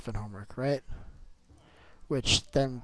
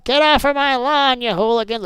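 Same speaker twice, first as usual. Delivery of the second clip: put-on shouting old man, much higher and louder; cranky old man; 12 semitones higher, 10 dB louder than usual. Grumpy old man yelling at kids on his lawn. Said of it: "pretty soon it's gonna be like".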